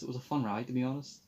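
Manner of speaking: ordinary talk, nothing marked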